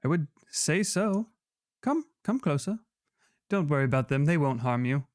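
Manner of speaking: lightly voiced coaxing figure; a hint of sly enticement; villain voice.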